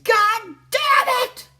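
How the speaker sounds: very high, slow, furious yell; angry dad shout. Retold or imitated. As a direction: blow up like a dad woken at night. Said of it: "Your dad would just go off".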